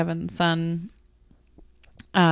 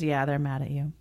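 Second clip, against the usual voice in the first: flat report; 6 semitones lower, 7 dB quieter, faster than usual. Retold or imitated. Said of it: "He goes".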